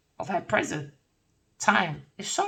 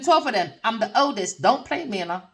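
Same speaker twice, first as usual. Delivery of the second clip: ordinary delivery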